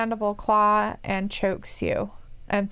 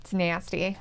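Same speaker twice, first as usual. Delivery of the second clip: ordinary talk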